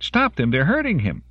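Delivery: high, desperate plea; crying out. A narrator's reading. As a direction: cry out for someone to step in. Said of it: "Denise cried".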